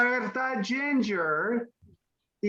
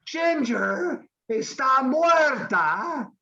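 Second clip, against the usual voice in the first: dramatic proclamation; 6 semitones higher, 3 dB louder, slower than usual. Acted. Teacher dramatic announcement.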